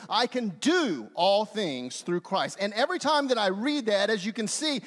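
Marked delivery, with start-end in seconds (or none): 0.1-2.6 "do" stretched long and pitched up; stress on DO. Shown as a demonstration. Preacher reads the verse with "do" circled, stressed on "do". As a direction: linger slowly on the word "do". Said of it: "this time I circle".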